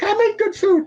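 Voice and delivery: very high screechy yell; shrill mock Amy. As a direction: screech it like an outraged owner. Amy yelling at the restaurant.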